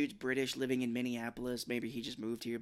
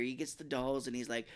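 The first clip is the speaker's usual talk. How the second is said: ordinary talk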